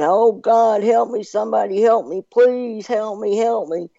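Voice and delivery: high-pitched retold cry for help; mock screaming. Man hanging off a cliff calling for rescue, mock screaming. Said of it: "he started screaming".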